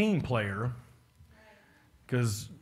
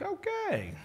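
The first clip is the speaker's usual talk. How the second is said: much higher, soft mock okay; a polite, strained acceptance; lightly hammed-up high okay; forced okay; 7 dB quieter than usual. Reacting to the complainers.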